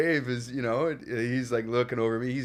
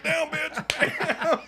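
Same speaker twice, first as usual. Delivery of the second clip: slow, much higher laughing mock yell; hammed-up yell; 7 dB louder, much slower than usual. New actor kicking down the door at the woman, hammed-up and slow. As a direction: stretch out the yell like a clumsy action hero. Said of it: "you're gonna yell".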